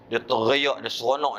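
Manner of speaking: ordinary delivery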